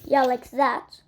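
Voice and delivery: ordinary talk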